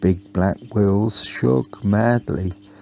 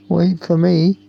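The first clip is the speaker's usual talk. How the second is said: loud, higher shout as he rushes to catch up; nervous shout; 10 semitones higher, 5 dB louder than usual. Acted. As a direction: call out so you're not left behind. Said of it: "shouted Bobby Bus".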